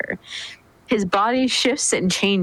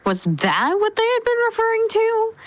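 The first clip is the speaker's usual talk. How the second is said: surprised; 12 semitones higher than usual. Acted. Frieza's surprised inner thought.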